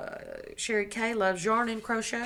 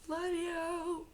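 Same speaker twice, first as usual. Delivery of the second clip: soft high love you; 10 semitones higher, 7 dB quieter than usual. Real, not acted. Thanking friend sweetly.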